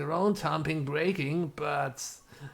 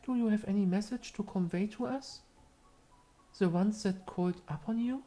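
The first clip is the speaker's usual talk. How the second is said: higher, solemn asking voice; seance questioner voice; 8 semitones higher than usual. Acted. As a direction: question the spirit gravely. Asks spirit in character.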